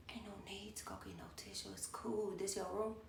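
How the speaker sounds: hushed, soft mock-seductive purr; put-on sexy voice. Woman at the party coming on to her, mock sexy voice. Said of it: "put on her little sexy voice".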